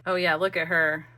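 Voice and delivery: ordinary talk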